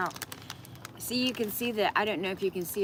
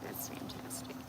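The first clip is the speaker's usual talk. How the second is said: whispered sarcastic aside; whispering; 13 dB quieter than usual. Real, not acted. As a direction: breathe it out as a dry whisper. Sarcastic aside after messy chicken.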